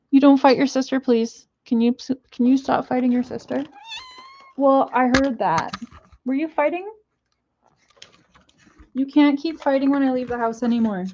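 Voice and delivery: high, sing-song telling off; pet scolding voice. Real, not acted. Owner telling off her pets, high and scolding.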